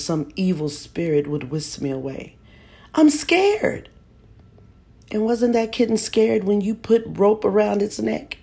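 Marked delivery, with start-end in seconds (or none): 2.9-3.9 put-on high, frightened little boy voice; scared child. A narrator's reading. Boy telling mother he's scared, high and frightened. 5.1-8.4 higher, louder accusing mother; stern mother. A narrator's reading. Mother accusing her son, stern and loud. Mother rebukes boy harshly.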